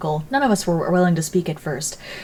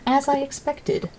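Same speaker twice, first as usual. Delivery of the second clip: higher, slow, mocking smugness; mock smug reading; 8 semitones higher, 4 dB quieter, slower than usual. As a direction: sneer it out slowly. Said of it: "Those words could very well mean".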